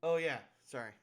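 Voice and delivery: normal talking voice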